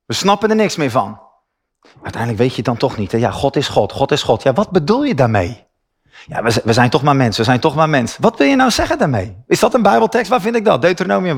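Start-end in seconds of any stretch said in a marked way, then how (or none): 2.0-4.4 lower, quick shrugging believer imitation; mock churchgoer cliché. Preacher mimics churchgoers' empty answers with a lower mild mocking voice. 6.3-8.2 lower, quick shrugging believer imitation; mock churchgoer cliché. Preacher mimics churchgoers' empty answers with a lower mild mocking voice.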